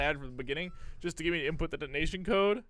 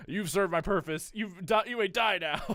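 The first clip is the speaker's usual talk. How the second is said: louder, higher villain declaration; villain threat; 5 semitones higher, 5 dB louder than usual. Acted. Liquid telling Snake he may die now, hammy and loud. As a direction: dismiss him like a cartoon villain.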